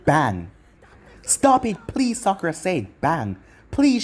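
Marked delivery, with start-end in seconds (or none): 1.2-2.2 pleading. A narrator's reading. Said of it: "she started to cry". 3.7-4.0 high, louder pleading; tearful plea. A narrator's reading. Sakura begging for Sasuke's life, tearful plea. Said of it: "she started to cry".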